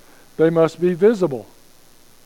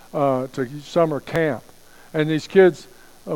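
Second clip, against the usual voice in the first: ordinary talk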